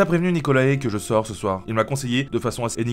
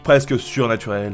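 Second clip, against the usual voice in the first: ordinary talk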